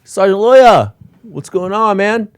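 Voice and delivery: high, loud taunting call; mocking loud call. Officer teasing Sergeant Loya at the gym, high loud taunt. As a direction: shout up high to tease him in front of the guys. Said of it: "I'd be like".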